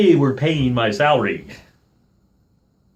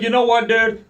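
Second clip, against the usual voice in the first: raised, higher, louder challenge; retold confrontation; 12 semitones higher, 5 dB louder than usual. Telling off the park worker, a raised angry challenge. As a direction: square up to him, voice up.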